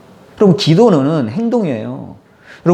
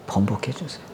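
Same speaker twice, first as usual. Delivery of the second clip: low, much softer begging; soft desperate plea; 5 semitones lower, 11 dB quieter than usual. Acted. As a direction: beg almost under the breath. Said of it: "통곡하면서 기도한 거예요". (아주 작게 간절히 애원)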